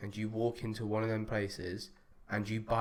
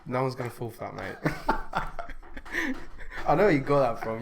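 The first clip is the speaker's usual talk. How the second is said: laughing; faster than usual. Real, not acted. Laughing at the milk story.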